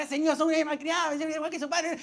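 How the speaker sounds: hint of the meddling grandma scolding; grandma yelling. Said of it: "estaba gritando cualquier cosa".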